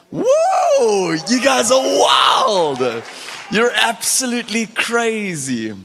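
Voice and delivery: high, loud, drawn-out whoa; excited shout. Real, not acted. Preacher shouting with delight at the waving youth.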